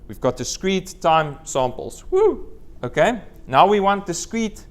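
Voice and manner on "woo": high, loud "woo"; short playful cheer far above speaking pitch; celebratory whoop. Pretend. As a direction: whoop it up briefly. Celebrates finishing a step.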